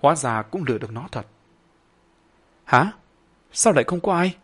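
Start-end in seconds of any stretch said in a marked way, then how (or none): 2.6-4.3 put-on surprised ghost child. A narrator's reading. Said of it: "ngạc nhiên thốt lên".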